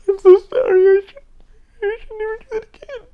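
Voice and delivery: high, squeaky, whimpering sorry voice; mock a cowering person begging forgiveness; mock groveling apology. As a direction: falsetto whine, half laughing, half crying. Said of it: "sit there in a corner and go".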